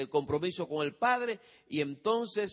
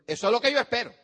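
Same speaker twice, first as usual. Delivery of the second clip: loud, higher, cool demand; cold, demanding parent; 6 semitones higher, 6 dB louder, much faster than usual. Retold or imitated. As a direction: brush off the good news. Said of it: "no le diga".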